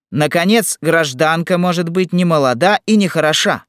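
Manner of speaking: high, loud officious objections; loud, high-pitched official rebuttal; objecting militia chief. Acted. Volunteer chief rebuts the verse.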